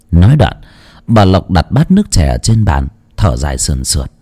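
ordinary delivery, nothing marked

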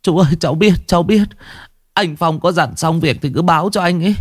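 high whimpering babble; snivelling. Acted. Boy Tùng snivelling answer to Vũ, high whimper. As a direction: whine it through near tears. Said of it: "Thằng Tùng mếu máo".

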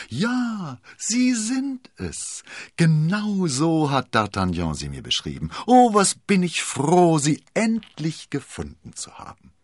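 high, loud, drawn-out delight; overjoyed exclamation. A narrator's reading. Milady greets Constanze as long sought, overjoyed. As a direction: gush with joy at finally finding her. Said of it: "rief überglücklich aus".

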